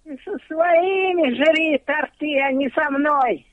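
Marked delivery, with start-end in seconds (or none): none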